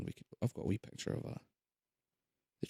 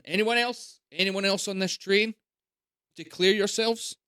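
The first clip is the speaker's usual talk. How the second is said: lightly voiced guard, higher, calling down the train; 9 semitones higher than usual. Guard searching the train for stragglers, calling out. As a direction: call out like a soldier checking carriages.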